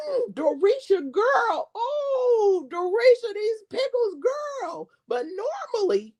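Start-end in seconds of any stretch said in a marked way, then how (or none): 0.1-4.9 high sing-song imitation of a food reviewer raving; imitating a food taster. Vlogger imitating a taster praising the pickles, high sing-song. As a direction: gush over the pickles in her catchphrase voice. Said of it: "she was like".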